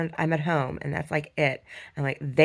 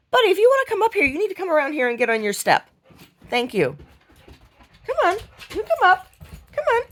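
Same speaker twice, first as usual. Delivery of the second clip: high, coaxing pet voice; talking to the dog; 12 semitones higher, 5 dB louder than usual. Real, not acted. Coaxing the dog onto his step in high pet talk.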